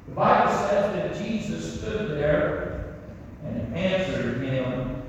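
ordinary talk, nothing marked

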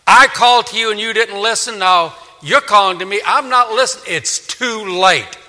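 loud, high, forceful judgment; thundering refusal, slowing at the end; God's stern rebuke. Acted. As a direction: cut off their pleas with full force. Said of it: "God says".

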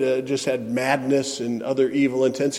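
ordinary delivery

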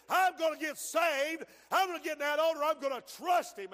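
insistent, building pulpit declarations; driving revival-preacher rhythm; fervent preaching. Acted. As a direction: declare each resolve forcefully to the congregation. Preaches as the determined woman.

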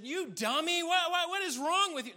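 loud, high comic outburst; mock exasperated scolding. Jesus berating sinking Peter in joke version, mock exasperated. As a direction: scold him in hammed-up disbelief.

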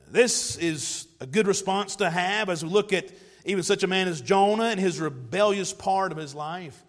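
ordinary delivery

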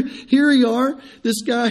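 ordinary talk, nothing marked

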